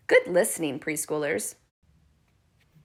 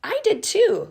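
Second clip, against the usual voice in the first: high and eager; eager high reply; 13 semitones higher, 5 dB louder than usual. Real, not acted. Teacher to preschoolers, eagerly agreeing she heard it. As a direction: share their excitement.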